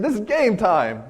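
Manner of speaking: excited Peter. Retold or imitated. Peter sees his moment to fight, excited. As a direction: relish it, eager for the fight. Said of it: "He was excited".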